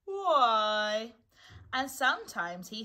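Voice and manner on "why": loud, drawn-out mournful why; sad. A narrator's reading. Eeyore thinking to himself alone, sadly. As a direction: sigh it out sadly, at full voice. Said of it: "he thought sadly to himself".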